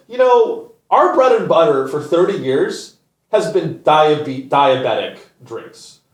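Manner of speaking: mock CEO. Mocking the PepsiCo CEO's pitch, hammed-up corporate voice. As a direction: play a smug executive, lightly. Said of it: "the C E O is like".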